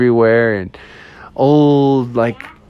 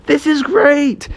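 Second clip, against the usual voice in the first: high, slow, drawn-out delight; delighted bears; 13 semitones higher, slower than usual. Acted. Bears enjoying the surprise party, high delighted voice. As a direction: stretch it out with high-pitched glee.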